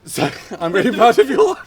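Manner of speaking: laughing. Real, not acted. Laughing praise.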